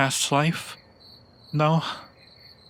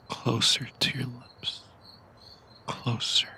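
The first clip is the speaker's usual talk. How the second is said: softer and softer, ending in a whisper; fading to a whisper; 5 dB quieter than usual. Acted. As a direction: draw them in, letting the voice sink to a breath. Vampire draws lover in to kiss.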